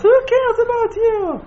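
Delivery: very high rhetorical outcry; indignant exclamation. Real, not acted. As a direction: fling the question out high and forceful. Heated rhetorical challenge to listeners.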